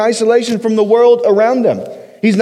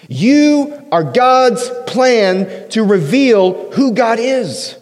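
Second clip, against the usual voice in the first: slow emphatic; 3 semitones higher, much slower than usual. Shown as a demonstration. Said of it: "stress on identity".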